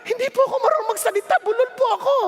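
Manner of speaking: put-on his young self pleading with the Lord; pleading excuse. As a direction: rush the excuse out. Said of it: "ganun ang tanong ko eh".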